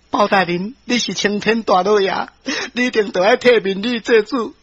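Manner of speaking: plaintive woman's plea to the judge; wronged wife begging. Acted. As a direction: beg him earnestly for justice. Wife begs judge for justice. (民女苦苦哀求)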